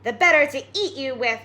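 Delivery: the wolf bursting out; wolf's cry. Acted. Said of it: "cried the wolf".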